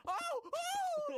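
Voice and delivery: two very high, loud shrieks; piercing mock screams of panic; imitated grandma scream. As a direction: scream as if jolted awake. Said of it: "She went".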